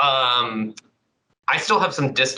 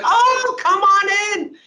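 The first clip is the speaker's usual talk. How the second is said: put-on much higher, louder sing-song old Irish lady; imitating Sheila's welcome; 4 dB louder, much slower than usual. Old Irish cousin greeting visitors, much higher and louder. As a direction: pitch it way up like a delighted old Irish lady at the door. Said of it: "And she's like".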